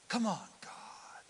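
slow whispered plea to God; exasperated whisper. Retold or imitated. Praying impatiently to God in a slow whisper. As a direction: breathe it out impatiently. Pleading under breath at delay.

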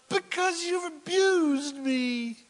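high, slow, loud put-upon complaint; indignant Balaam. Acted. Balaam complaining to the donkey, high and indignant. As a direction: drag out his wounded pride. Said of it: "And Balaam said to the donkey".

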